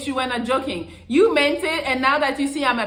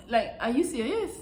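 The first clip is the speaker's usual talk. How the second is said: usual speaking voice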